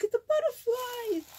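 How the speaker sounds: high, loud squeal of delight; gushing and high-pitched. Real, not acted. Delighted by gift pieces.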